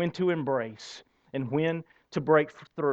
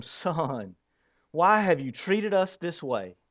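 slow, mild scripture reading; benign; slower than usual. Acted. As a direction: read it slowly, with no upset in it. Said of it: "that all sounds kind of benign".

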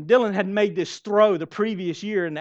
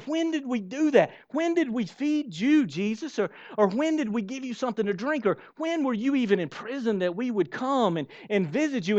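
puzzled asking; 6 semitones higher than usual. Acted. People questioning Jesus, amazed.

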